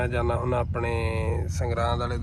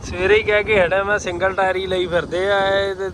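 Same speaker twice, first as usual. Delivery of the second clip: raised voice; 6 semitones higher, 3 dB louder than usual. Real, not acted. Talking loudly over machine.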